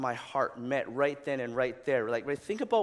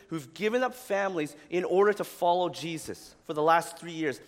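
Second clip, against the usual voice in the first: ordinary talk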